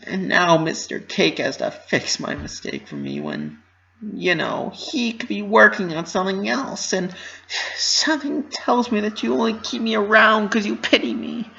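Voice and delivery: high, bright pony voice, anxious and earnest; a young pony rushing through her worries, pitched high; Pinkie Pie voice. Acted. Pinkie fears being pitied.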